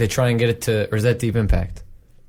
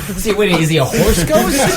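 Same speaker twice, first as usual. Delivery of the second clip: laughing; 7 semitones higher than usual. Real, not acted. Laughing at ghost joke.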